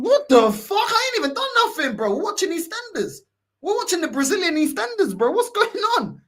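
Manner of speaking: high-pitched, incredulous mock outrage; bewildered protest. Man acting out shock at being attacked during TV, high bewildered protest. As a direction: protest your innocence, pitch leaping up.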